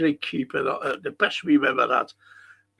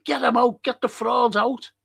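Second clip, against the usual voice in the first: mock chant of angry fans, pitched much higher; fans calling out; 7 semitones higher than usual. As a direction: call it out like a fed-up crowd. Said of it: "people are saying".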